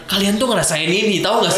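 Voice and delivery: high, pushy seminar motivator voice; booming motivational speech. Retold or imitated. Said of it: "kayak motivator-motivator di seminar". (suara motivator seminar yang menggebu)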